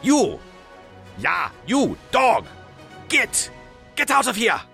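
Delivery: high, forceful shouted commands; shouting the dog away. Acted. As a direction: drive a dog off with short shouts.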